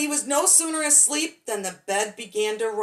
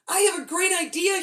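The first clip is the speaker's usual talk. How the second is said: higher, louder, bursting with his idea; excited shout; 8 semitones higher, 4 dB louder than usual. A narrator's reading. Billy bursting to tell everyone, excited shout. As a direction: blurt it out like a thrilled kid. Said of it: "he shouted".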